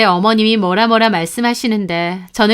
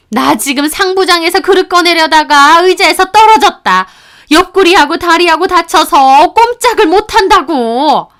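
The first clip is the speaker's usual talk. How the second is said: higher, louder complaining yell; mother-in-law yelling; 7 semitones higher, 6 dB louder than usual. Retold or imitated. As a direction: yell out the injury dramatically. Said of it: "소리는 잘만 지르시더라고요". (의자에서 떨어졌다고 소리치는 시어머니, 높고 큰 목소리)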